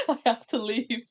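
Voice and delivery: words squeezed out between high bursts of laughter; can't stop laughing, barely getting words out; co-host cracking up, trying to leave the podcast, laughing; laughing fit. Real, not acted. Co-host breaks into helpless laughter.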